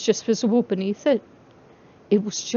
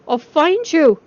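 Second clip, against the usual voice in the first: higher, louder desperate call; 6 semitones higher, 6 dB louder, slower than usual. Acted. Charlie calling to vanished babies in the dark, loud. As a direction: shout it through the fabric to the lost children. Charlie searching through fur costumes.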